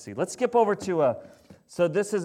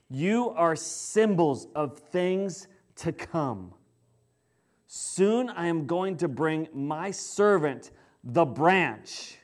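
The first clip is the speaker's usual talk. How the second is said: scripture reading; 3 semitones lower, 3 dB quieter than usual. Acted. Reads Zechariah, the Lord's message.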